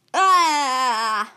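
loud drawn-out ah; exasperated cry. Real, not acted. Taster reacting to Toxic Waste candy, loud drawn-out cry. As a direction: let out a long, loud ah.

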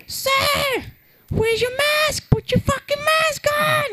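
high, mock shrill store clerk yelling; shouting store clerk. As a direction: squeal it like a bossy guard at the door.

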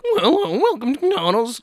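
hammed-up nerd cashier greeting; nerdy cashier. Nerdy cashier voiced in comic.

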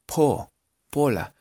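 Paula built up slowly as "po... pola"; slow down and open Paula into "pola". Shown as a demonstration. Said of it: "como una O abierta". (Paula lento, con O abierta, "pola")

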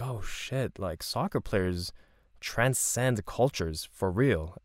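slow, soft, awed; slow realisation. Retold or imitated. Young traveller realising soccer transcends cultures, hushed and slow. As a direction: let the realisation sink in slowly. His own realisation retold.

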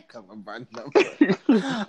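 slow laughing echo. Real, not acted. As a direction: savour it through laughter. Laughing repeat of quip.

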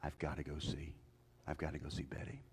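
low, quick, hushed urging to his wife; husband urging his wife, low and hurried; urgent low plea. Retold or imitated. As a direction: press her softly but fast. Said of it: "I said".

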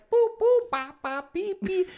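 high squeaky boop-beep toots; clown toot noises. Retold or imitated. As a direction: toot like a tiny clown train. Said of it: "he's like".

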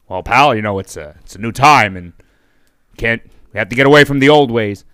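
Mister McMahon. Acted. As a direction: brush the caller off as the boss. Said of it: "Mister McMahon".

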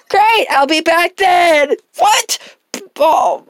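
high, loud whiny customer voice; high squeaky customer. Acted. Customer character voice.

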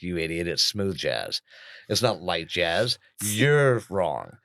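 low, muted self-scolding; self-abasing. Retold or imitated. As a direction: scold yourself under your own steam, softly. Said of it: "self-abasing myself".

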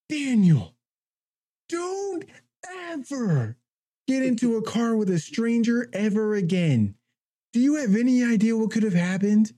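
high, stern mock telling-off with each word leaned on; stern scolding. Bus driver scolding the boy in front of all the kids, high stern telling-off. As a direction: play the outraged bus driver laying down the law.

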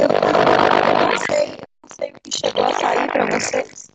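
glitchy robotic voice over a bad connection; distorted robot voice, breaking up. Real, not acted. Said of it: "você tá com uma voz de robô".

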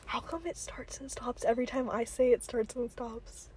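barely audible, secretive whisper. Real, not acted. Asking viewers about noise next door, whispered. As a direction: whisper it to the camera. Whispering so neighbours don't hear.

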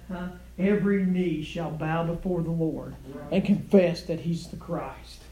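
ordinary delivery

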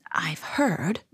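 hushed, secretive storyteller whisper; soft whisper full of mystery; mysterious whisper. A narrator's reading. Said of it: "he whispered mysteriously".

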